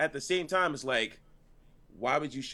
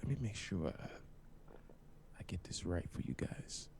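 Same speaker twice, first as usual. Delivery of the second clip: hushed, drawn-out words close to the mic; low, slow near-whisper with long pauses; slow and deliberate; 6 semitones lower, 11 dB quieter than usual. Shown as a demonstration. Podcaster setting the chat straight, slow and deliberate. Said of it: "I just wanna say this slowly".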